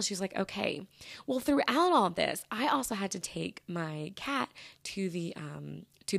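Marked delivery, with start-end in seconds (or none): 0.4-0.9 soft, unbothered reply; imitating someone unfazed, quietly; chill. Mom taking the late appointment in stride, chill. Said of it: "she was just chill".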